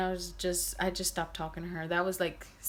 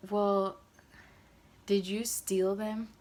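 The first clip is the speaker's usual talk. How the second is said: slow, deliberate, leaning on did; slow pointed question; much slower than usual. Retold or imitated. Asking Letty slowly, with weight, if she stole the shoes. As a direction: drag it out so she has to answer. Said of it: "I said it like that".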